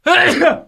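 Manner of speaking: huge explosive sneeze; big dad sneeze. Real, not acted. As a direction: let rip a massive sneeze. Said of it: "I'm gonna do a big dad sneeze".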